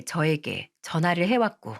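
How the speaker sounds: ordinary delivery, nothing marked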